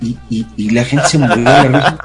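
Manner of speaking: ordinary talk, nothing marked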